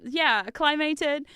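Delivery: ordinary talk, nothing marked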